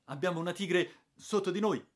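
high, loud warning yell; yelling that a tiger is right below; alarmed shout. Acted.

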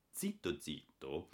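soft, slow and hushed. A narrator's reading. Boy sneaking upstairs, softly and slowly. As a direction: tiptoe the words softly.

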